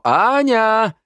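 high, loud searching call; calling out loudly. A narrator's reading. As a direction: sing out to someone in another room. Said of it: "закричал Лео". (громко, высоко кричать)

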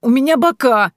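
high, loud groan of dismay; moaning. A narrator's reading. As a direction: wail it in self-pity. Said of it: "простонала".

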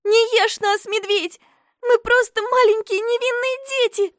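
put-on high, loud frightened girl; a child's panicked shout. A narrator's reading. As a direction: cry out to the bear in fear. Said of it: "И тут Хюльда прокричала".

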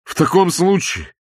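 loud, high, slowing proclamation; wretched husband's cry. A narrator's reading. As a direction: declare it as a loud protest. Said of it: "закричал несчастный муж". (несчастный муж, громкий выкрик)

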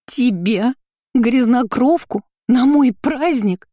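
high, incredulous mockery; scornful amusement. A narrator's reading. Curly-haired girl mocking an uninvited sister, scornful amusement. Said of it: "Кудрявая громко расхохоталась".

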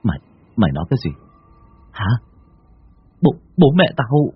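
fast stammered disbelieving question; stunned stammering question; stammering in shock. Acted. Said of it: "Đen lắp bắp".

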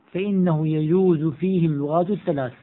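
normal talking voice

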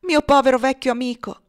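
loud, high cry to a dear friend; anguished exclamation. A narrator's reading. Young man kneeling by his dying friend, exclaiming in anguish. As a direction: call out in grief and shock. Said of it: "esclamò inginocchiandosi presso di lui".

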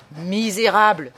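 loud, outraged insult; furious howl. Acted. Traveller losing all restraint at mourners, furious howl. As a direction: hurl the insult at full voice. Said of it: "hurlai-je".

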